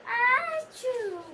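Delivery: high, loud achoo; loud acted sneeze. Gerald sneezes again at Piggie. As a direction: sneeze it out big.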